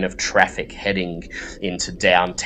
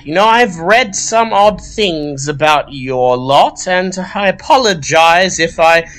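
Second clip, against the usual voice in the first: high, louder, softened librarian voice; friendlier librarian; 9 semitones higher, 4 dB louder than usual. Acted. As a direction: thaw a little, apologise grudgingly. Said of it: "she says".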